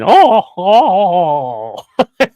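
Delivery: high, loud cat yowl sliding down; imitating a yowling cat. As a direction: mimic the cat wailing before being sick. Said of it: "And he's going".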